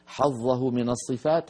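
ordinary talk

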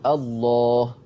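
الله with a full, dark "ll" and backed long "aa"; heavy lam. Shown as a demonstration. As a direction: draw out الله with the tongue back, tafkhim on the lam. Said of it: "هتفخمه".

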